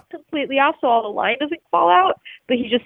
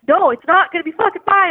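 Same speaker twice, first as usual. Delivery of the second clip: high, rising panic, a bit louder; freaking out; 7 semitones higher, 3 dB louder than usual. Retold or imitated. Fisherman freaking out to his friend, high panicked voice. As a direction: blurt it out in mock panic. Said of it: "I'm freaking out".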